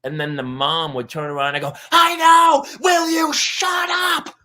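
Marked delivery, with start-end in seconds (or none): 1.9-4.3 high, exasperated shout; imitated mom yelling back. Mom turning round on her father, yelling back. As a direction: scream it slowly at full volume. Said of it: "yelling at the mom who was yelling".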